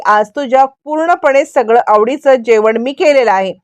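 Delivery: ordinary delivery, nothing marked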